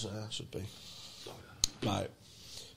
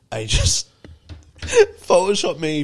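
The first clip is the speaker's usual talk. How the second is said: slow, giggling through the request; laughing; 6 semitones higher, 4 dB louder, slower than usual. Retold or imitated.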